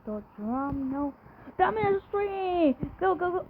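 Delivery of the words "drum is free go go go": excited cheering. Real, not acted. Excited urging in game.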